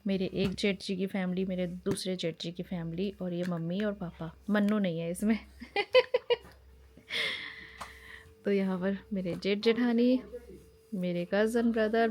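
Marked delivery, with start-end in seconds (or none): 5.6-6.4 high laugh. Real, not acted. Laughing at family photo.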